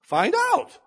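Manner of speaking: sudden high, loud shout; shouted command. Real, not acted. Preacher shouting at the congregation to find out. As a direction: bark it at the congregation. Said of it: "preaching emphasis".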